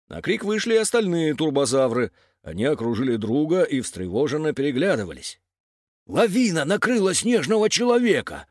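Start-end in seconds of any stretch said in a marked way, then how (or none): 6.1-8.4 high-pitched urgent news; blurted alarm. A narrator's reading. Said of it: "выпалил Зер". (взволнованно выпаливает)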